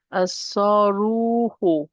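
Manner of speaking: slow As-saruhu with heavy doubled S; no L, long held "ruu" in As-saruhu; lam dropped. Shown as a demonstration. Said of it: "I'm dropping the lam".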